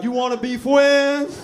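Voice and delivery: put-on high, loud little-kid voice; childish mock voice. Comic mocks the friend with untied shoes as a small child, high and loud. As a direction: sound like a kid offering milk and cookies.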